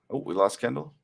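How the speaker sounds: plain reading voice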